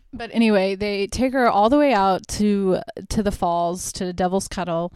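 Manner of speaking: normal talking voice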